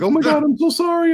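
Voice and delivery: high, frantic mock panic; imitating a panicked guy saying sorry; panicked apology. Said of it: "Just panic".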